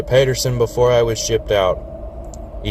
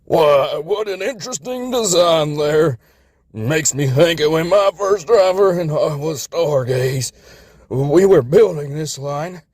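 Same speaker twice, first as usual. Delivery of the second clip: put-on higher, reminiscing old-timer; rambling old engine; 6 semitones higher than usual. A narrator's reading. Florida rambling about stargazing, old and fading. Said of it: "rambling to no one in particular".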